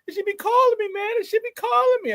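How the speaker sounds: very high, whiny mock-addict squeal; Pookie. Host jonesing for Bears talk, mock-addict impression. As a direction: squeal it like a craving junkie, hammed up. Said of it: "I feel like Pookie in New Jack City".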